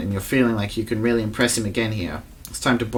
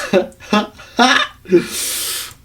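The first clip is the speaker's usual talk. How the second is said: manic laugh; 10 semitones higher, 5 dB louder than usual. Acted. Spice hallucination trip.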